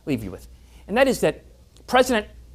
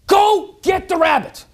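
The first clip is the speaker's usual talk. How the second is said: higher, drawn-out order to the dog; master's command; 11 semitones higher, 5 dB louder, slower than usual. Acted. Master orders dog to chase the rabbit, higher and drawn out. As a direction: send the dog off firmly and slowly.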